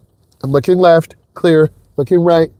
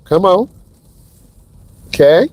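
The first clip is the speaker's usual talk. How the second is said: usual speaking voice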